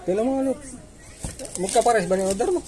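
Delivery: ordinary talk, nothing marked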